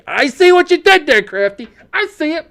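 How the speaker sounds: high-pitched teasing squeal; exaggerated high playful gotcha; playful mock-scolding. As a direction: call out the joker in a high voice. Teasing Crafty about the wordplay.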